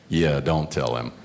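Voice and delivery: a sly angel agreeing with the devil; little angel voice. Retold or imitated. The angel on his shoulder agrees, mock slow angel. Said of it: "a little angel popped up on my shoulder and said".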